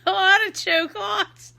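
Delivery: put-on high, slow accent through laughter; put-on Edith Bunker accent. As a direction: squawk it in a drawn-out comic accent. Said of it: "I just said it that way".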